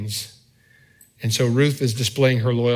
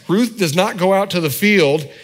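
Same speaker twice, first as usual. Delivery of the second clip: ordinary talk, nothing marked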